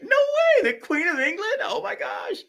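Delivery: squeaky falsetto fading to a breathless whisper; shrill, then unvoiced, gasp of awe; mock starstruck gush. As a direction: gush like a fangirl hearing about royalty. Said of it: "He thought that I was gonna be like".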